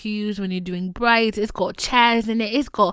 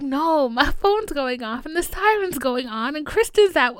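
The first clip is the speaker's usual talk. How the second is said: high, rambling worried plea; mock panic; 6 semitones higher, 4 dB louder than usual. Scared daughter tells her mother the sirens are going, mock panic.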